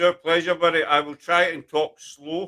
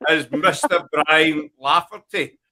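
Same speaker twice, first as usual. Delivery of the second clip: normal talking voice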